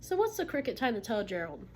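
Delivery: ordinary talk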